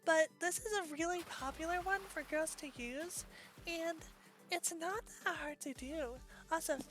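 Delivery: put-on quiet, close-mic girl voice; soft breathy streamer voice; soft VTuber voice. Shown as a demonstration. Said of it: "I could also use this one".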